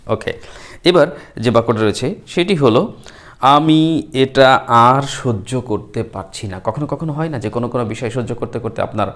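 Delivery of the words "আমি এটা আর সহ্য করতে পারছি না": slow model sentence. Shown as a demonstration. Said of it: "teacher models sentence".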